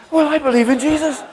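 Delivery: loud declaration broken by a laugh. Retold or imitated. Answering the hitchhiker's question, laughing loudly. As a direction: blurt it out through a laugh.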